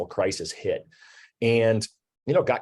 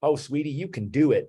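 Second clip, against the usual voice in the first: lightly imitated Texas drawl, pitched a little higher; a hint of encouraging Texas sweetie-talk, higher voice; Linda Davidson's Texas drawl; 5 semitones higher than usual. Mentor cheering on a struggling loan officer, light imitated Texas drawl. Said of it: "in her Texas drawl".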